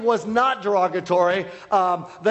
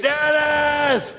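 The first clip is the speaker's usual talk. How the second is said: high, long-held holler; imitated yelling; 8 semitones higher than usual. Lady calling him out every hour, imitated yell. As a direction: holler the name across the street. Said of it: "start yelling in the middle".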